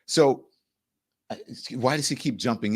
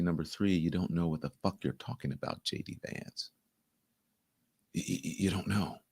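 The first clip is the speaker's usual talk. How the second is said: hushed, low and measured; calm quiet voice; 5 semitones lower, 11 dB quieter than usual. Retold or imitated. The president quietly putting down the interrupting vice president, calm and hushed. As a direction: keep it soft and controlled, barely above a murmur. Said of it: "this man is so calm".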